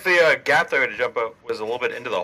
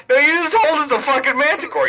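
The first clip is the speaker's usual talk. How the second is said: indignant high protest; 14 semitones higher, 5 dB louder than usual. Real, not acted. Player protests GM slip.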